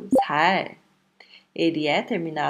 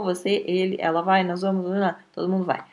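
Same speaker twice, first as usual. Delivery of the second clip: ordinary delivery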